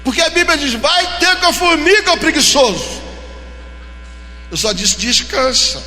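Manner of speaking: loud, high fervent preaching; preaching at full force. Acted. Pastor exhorting the unemployed, preaching loudly.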